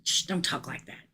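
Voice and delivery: put-on whispered shush, scolding a child; a whispered shh, don't; hushing whisper. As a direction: hush them in a whisper. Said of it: "everybody says".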